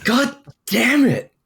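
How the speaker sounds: high, drawn-out cry of frustration; exasperated wail; frustrated outburst. Real, not acted. Player frustrated at fumbled roll.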